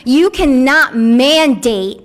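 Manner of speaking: usual speaking voice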